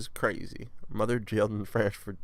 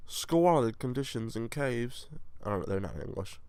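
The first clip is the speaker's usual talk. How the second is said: ordinary talk, nothing marked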